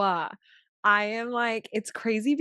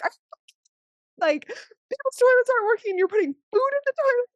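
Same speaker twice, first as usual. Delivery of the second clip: very high, louder exasperated outrage; incredulous scolding; 4 dB louder than usual. Retold or imitated. To husband Brian over the toilet, very high exasperated scolding. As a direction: let him have it, half laughing.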